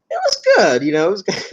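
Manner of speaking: high-pitched praise, barely holding back a laugh; trying and failing to sound serious; failing straight face. Real, not acted. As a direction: say it insincerely, about to crack up. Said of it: "I can't even say it with a straight face".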